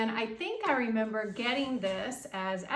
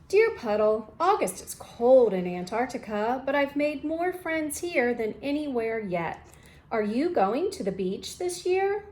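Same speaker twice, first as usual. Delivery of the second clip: lively, louder storybook read-aloud; reading to children; 7 dB louder than usual. Acted. Teacher reading a picture book to students, lively storytime voice. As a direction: read it brightly for the class.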